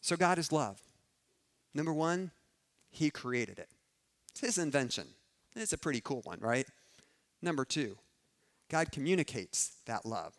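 loud, pressing preacher's build; preaching at full force. Acted. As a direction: drive the conviction home to the room.

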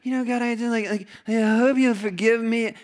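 mock sheepish, hesitant plea; hammed-up apologetic mumble; a hint of a nervous confessor; timid sinner. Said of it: "We don't have to come up and like".

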